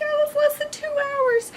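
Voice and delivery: very high, whiny mock protest; hammed-up squeaky alarm; acted dismay. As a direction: squeak it out as an imitated complaint. Acts her alarmed protest at deadline.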